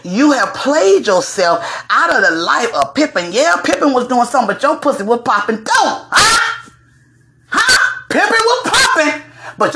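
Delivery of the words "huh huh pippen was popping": very high-pitched goading "huh?"; high, taunting comeback; mock challenge. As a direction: press the point in a high squeal. Mock challenge to Larsa, repeating point.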